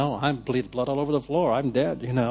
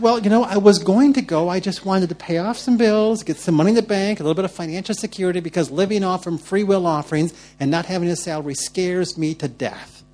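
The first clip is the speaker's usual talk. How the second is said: loud, high, pleading excuses; defensive protest; 6 semitones higher, 6 dB louder than usual. Retold or imitated. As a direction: justify yourself in a raised voice, easing off at the end. Retold anxious self-defence to God.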